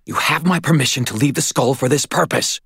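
desperate. A narrator's reading. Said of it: "I thought desperately".

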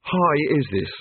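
broken-up croak after a shock. Acted. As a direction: croak it out in dismay. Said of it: "he croaked".

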